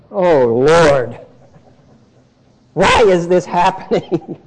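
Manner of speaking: weary sighing plea, "Oh" drawn out and falling; exasperated complaint. Shown as a demonstration. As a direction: groan "Oh Lord" like a fed-up believer. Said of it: "you almost say it that way".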